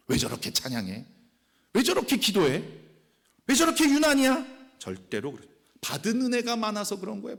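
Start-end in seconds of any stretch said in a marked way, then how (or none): 0.1-4.5 mocking critic.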